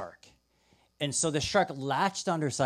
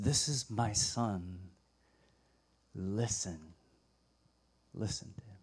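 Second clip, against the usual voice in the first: voice of God; 5 semitones lower, 6 dB quieter than usual. Acted. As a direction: speak with hushed, measured authority. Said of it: "then this voice comes".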